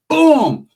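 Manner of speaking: high, loud exclaimed boom; loud punch sound. Retold or imitated. Old man swinging on a rival, loud high impact noise. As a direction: punch the word out like a blow.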